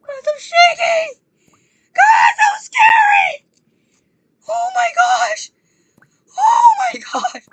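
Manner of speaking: very high, loud squealing panic; scared yelling. Real, not acted. Gamer shaken by a scare tells viewers, squealing scared yell. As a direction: shriek it slowly, scared out of your wits. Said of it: "I'm sorry for yelling".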